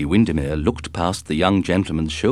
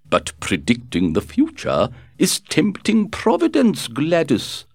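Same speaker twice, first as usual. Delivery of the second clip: put-on a delicate elderly duchess, gently chiding; frail withering duchess; 9 semitones higher than usual. A narrator's reading. Duchess speaks to Gladys.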